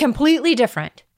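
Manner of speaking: high, loud, quick echo; quick, high emphatic echo; emphatic agreement. Real, not acted. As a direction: fire it back with conviction. Echoing host emphatically.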